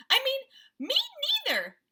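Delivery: high squeaky mouse correcting itself; tiny mouse voice. Acted. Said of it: "said a tiny little voice".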